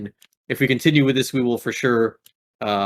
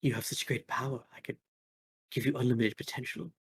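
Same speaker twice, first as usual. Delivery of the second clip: softer, coaxing, almost hushed; sultry villainess murmuring an offer; soft seductive villain; 2 semitones higher, 10 dB quieter than usual. Acted. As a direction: lure them in gently. Villain tempts player.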